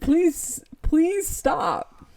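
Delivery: high-pitched begging; put-on helpless plea; mock pleading. Mom begging creepy kid to stop.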